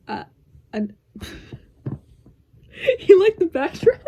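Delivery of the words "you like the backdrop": high, loud playful question. Real, not acted. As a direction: call it out teasingly. Loud joking aside to editor.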